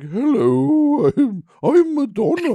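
mock duchess, very high and loud; hammed-up British grande dame accent. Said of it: "presenting herself as if she was a duchess".

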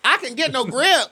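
squeaky high parrot of her line; high mocking echo. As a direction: echo it back in a high teasing voice. Friend mockingly echoes the complaint.

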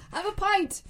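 high-pitched attempt at an Irish accent; bad Irish accent. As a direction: try Irish again, playfully overdone. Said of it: "Gotta work on your Irish accent".

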